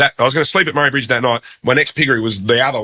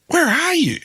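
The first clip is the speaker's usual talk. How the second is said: mock baffled radio host, pitched way up; incredulous question; slower than usual. Radio host to caller, hearing pigs: incredulous high question. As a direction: imitate a bloke who can't believe his ears.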